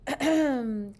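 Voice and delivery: loud attention-getting ahem; throat clearing. A narrator's reading. Missus Burton calling the class to attention, throat clear. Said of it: "cleared her throat".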